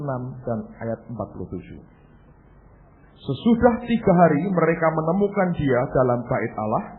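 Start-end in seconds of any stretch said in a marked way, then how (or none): 3.2-6.8 loud, projected preacher's reading; scripture reading. A narrator's reading. Preacher reading Luke to congregation, loud projected scripture reading. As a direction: proclaim the verse to the whole congregation, louder than talk. Reads the Bible passage aloud.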